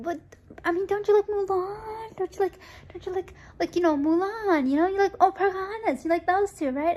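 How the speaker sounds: high, coaxing relative voice; pushy relative, high. Retold or imitated. Relative coaxing the child to like Mulan, high and eager. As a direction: imitate them nudging her toward Mulan. Family pushing Mulan on her.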